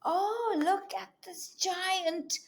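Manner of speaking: soft hushed "oh, look"; awed whisper. Acted. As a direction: whisper in wonder at the giant balloon. Revealing giant balloon.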